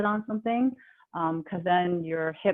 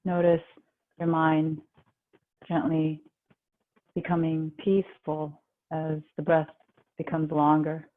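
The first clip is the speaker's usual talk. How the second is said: calm, even, unhurried relaxation guide; guided meditation; 3 semitones lower than usual. Real, not acted. Yoga teacher guiding the class into relaxation, calm guided meditation.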